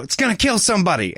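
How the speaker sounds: high and fast, blurting in alarm; blurted alarm. Retold or imitated. Harry warning his friends as he runs, blurting. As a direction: rush it out in panic. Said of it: "he blurts out".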